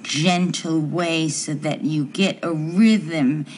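slow it right down to show the steady rhythm. Shown as a demonstration. Wife to husband, demonstrating the rhythm, slowly and evenly. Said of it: "in a very steady, gentle way".

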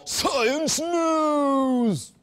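slow, high, drawn-out sign-off yell; loud stretched-out sign-off; drawn-out shout. Acted. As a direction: bellow the sign-off like a showman. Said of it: "Sorry about that".

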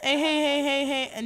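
normal talking voice

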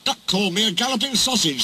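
fast, high indignant splutter. A narrator's reading. Gordon reports an insult to the controller, spluttering. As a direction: blurt it out in offended outrage. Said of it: "spluttered Gordon".

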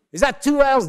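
loud, high questioning barista; querying barista. Acted. Starbucks barista asks for his name, loud high query.

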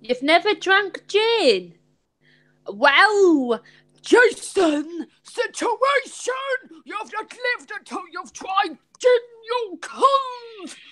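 high, hammed-up Irish accent outburst; Jason. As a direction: stretch it high in mock Irish outrage. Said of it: "I'm doing an impression of you".

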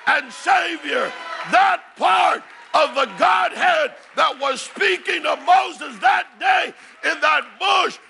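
high, ringing gospel cry; full-force preaching. Real, not acted. Preacher proclaims Yahweh to congregation, impassioned high preaching. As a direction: proclaim each title at full preaching pitch.

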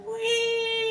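long high squealed whee; gleeful whee. Pretend. Pretending to shoot out of the tunnel, playful high whee. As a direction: squeal it like a fairground ride. Mock ride through a tunnel.

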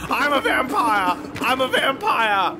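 mock Nick Cage shouting; Nick Cage yelling. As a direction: yell like manic Nick Cage. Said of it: "Nick Cage running down the street going".

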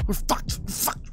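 breathless whispered despair. Real, not acted. Gamer whispering to himself as the sled fails, hushed despair. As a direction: whisper it as the run falls apart.

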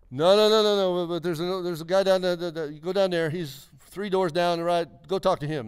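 high, stammering burst of no's, then directions; flustered refusal. Retold or imitated. Receptionist sending a strange visitor down the hall, flustered.

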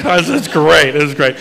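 ordinary talk, nothing marked

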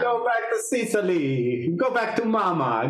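mock Italian. Mock taunt to Italian guitarist.

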